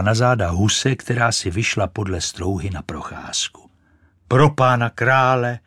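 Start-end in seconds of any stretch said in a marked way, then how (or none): 4.3-5.6 louder startled cry; storybook shock, raised; exclaiming goose. A narrator's reading. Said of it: "zvolala husa".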